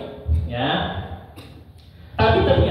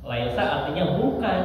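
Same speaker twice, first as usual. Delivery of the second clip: ordinary talk, nothing marked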